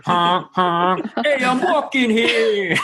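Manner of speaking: hammy Brooklyn street guy, high and slow; silly New York accent. Pretend. New York cabbie impression joke.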